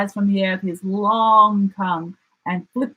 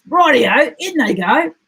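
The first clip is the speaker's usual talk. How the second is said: higher and louder, eager; 7 semitones higher, 5 dB louder than usual. Acted. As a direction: jump at the idea. Dingo eager agreement.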